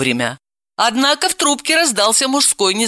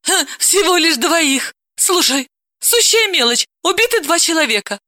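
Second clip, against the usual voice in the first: much higher, mocking nervous irony; nervous sarcasm; 11 semitones higher than usual. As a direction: brush it off with forced irony. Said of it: "нервно хихикнула".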